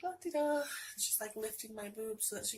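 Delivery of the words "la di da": mock carefree la-di-da.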